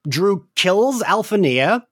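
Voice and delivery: ordinary talk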